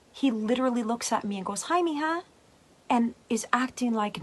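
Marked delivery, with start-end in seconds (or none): 1.6-2.2 much higher, breezy hello as dad; imitating dad's casual hi; cheerful greeting.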